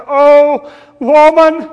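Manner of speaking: loud, high, emphatic cry; compassionate exclamation. Retold or imitated. Preacher voicing Jesus answering the woman, emphatic compassion. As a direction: call it out with great feeling. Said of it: "can't you just hear the compassion in His voice".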